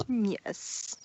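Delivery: snooty reply. Acted. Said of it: "replied in a rather snooty voice".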